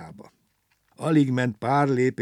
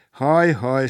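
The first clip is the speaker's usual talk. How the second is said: sighing; 4 semitones higher, 8 dB louder than usual. A narrator's reading. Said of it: "sóhajtozott Gombóc Artúr".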